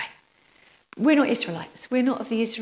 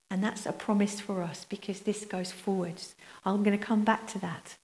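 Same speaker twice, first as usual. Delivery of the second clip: usual speaking voice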